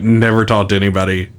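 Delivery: usual speaking voice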